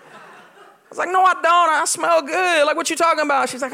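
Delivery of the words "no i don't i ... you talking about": indignant denial. Retold or imitated. Retold denial that he stinks.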